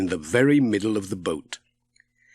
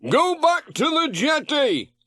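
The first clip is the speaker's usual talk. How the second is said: much higher, called across the water; Ted shouting. A narrator's reading. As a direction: shout it to Pat from the sailing boat. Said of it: "Ted shouted".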